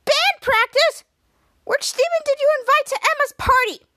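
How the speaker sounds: high, loud outraged yelling; screaming. Retold or imitated. Piper yelling at Jasper about the wrong Steven, high and loud. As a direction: yell at him in disbelief. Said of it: "she continued screaming".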